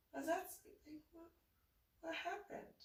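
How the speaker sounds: worried mom. Retold or imitated. Mom asking her son what went wrong, high and anxious. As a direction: rise up high with motherly worry.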